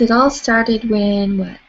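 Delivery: usual speaking voice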